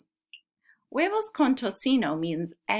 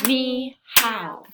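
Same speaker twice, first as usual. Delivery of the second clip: "Ni hao" as two even, louder clapped beats; "ni - hao", clap each one; "Ni hao" tapped out in two claps; clapped syllables; 3 semitones higher, 5 dB louder than usual. Shown as a demonstration. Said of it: "Let's clap it".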